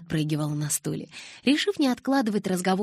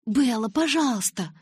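higher-pitched, mildly wheedling plea; pleading; 6 semitones higher, 3 dB louder than usual. A narrator's reading. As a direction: beg your friend, voice lifted. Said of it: "взмолилась Джессика".

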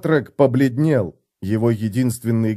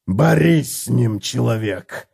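through gritted teeth; slower than usual. A narrator's reading. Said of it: "сквозь зубы процедил Готрек".

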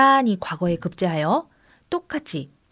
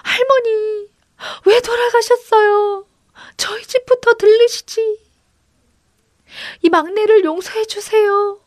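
high, loud, wailing lament; bitter weeping; 13 semitones higher, 5 dB louder than usual. A narrator's reading. Granddaughter over her dead grandmother, weeping bitterly. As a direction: wail the words out in grief. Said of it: "너무도 서럽게 울었습니다".

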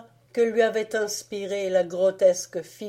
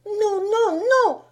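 put-on much higher, loud girl's protest; Marie crying out; 14 semitones higher, 7 dB louder than usual. Marie refusing Fritz, shrill high protest. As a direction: cry no like a desperate little girl. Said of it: "s'écria Marie".

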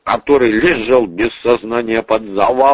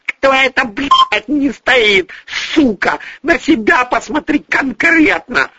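high-pitched furious outburst; angry snarl. Acted. Julia hits back at the accusation, snarling high. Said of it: "зарычала Юлия".

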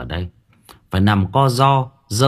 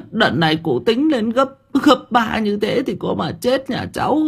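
put-on much higher, thin old woman's voice begging for mercy; pleading old woman; 12 semitones higher than usual. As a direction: plead with the master for your life. Old woman begs the official.

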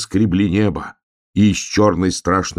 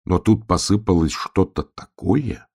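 ordinary delivery

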